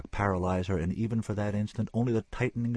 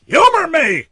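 full-throated bellow, much higher and louder; 18 dB louder than usual. Acted. Senora bellowing at the chief surgeon. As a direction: roar the order at him. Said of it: "she bellowed".

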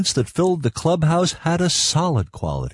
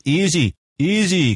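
mild, higher-pitched protest; 5 semitones higher than usual. A narrator's reading. Scientist calms the excited boy, mildly protesting. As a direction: rein them in with drawn-out words. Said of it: "Cargraves protested".

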